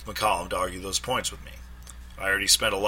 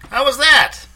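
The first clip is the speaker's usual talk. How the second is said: high, loud, pleased question; fishing for praise after the act; eager Trey; 13 dB louder than usual. A narrator's reading. Trey asking his friend how the call went, high and pleased.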